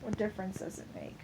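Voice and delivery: resigned near-mumble, said fast; defeated quiet. Real, not acted. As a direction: let the question die under your breath. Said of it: "repeated line, dropped to near silence".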